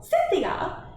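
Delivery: high, loud, falling summons; impatient lady's shout for someone; impatient call. A narrator's reading. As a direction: call her sharply, out of patience.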